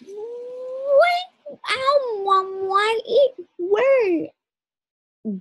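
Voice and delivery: put-on high, slow pained child cry; high hurt child voice. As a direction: squeak it out like a hurt little girl.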